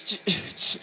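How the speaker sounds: scared stammer. Acted. Scared player stammering at spider.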